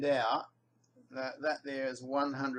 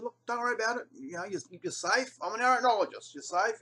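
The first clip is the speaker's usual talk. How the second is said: reassuring; 8 semitones higher, 6 dB louder than usual. Retold or imitated. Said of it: "I said".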